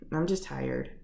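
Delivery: low, weary-sounding brush-off; evasive excuse. Acted. Upset person making excuses to a loved one, evasive and low. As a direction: play tired to dodge the question.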